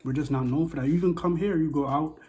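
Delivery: ordinary talk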